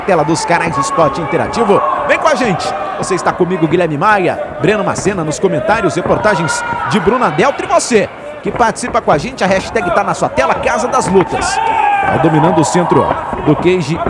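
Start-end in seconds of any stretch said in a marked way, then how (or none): none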